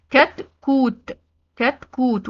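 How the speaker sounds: "كتكوت" drawn out, ending on a released t; final ت as T. Shown as a demonstration. Teacher modelling the open taa, final t sounded in "كتكوت". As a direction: stretch "كتكوت" slowly and stop on a clear t. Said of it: "لأنها اتنطقت تاء".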